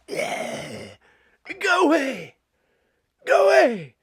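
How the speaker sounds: high, loud growling shout; hammed-up miserable snarl, far above normal pitch; mock grumpy comedian. Comedian scaring off an approaching fan, mock grumpy shout. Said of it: "they think we're gonna go like this".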